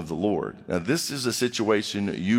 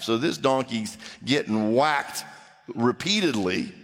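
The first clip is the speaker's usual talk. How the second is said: ordinary talk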